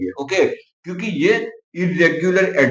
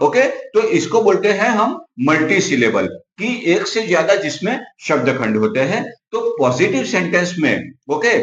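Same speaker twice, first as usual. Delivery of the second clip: ordinary talk, nothing marked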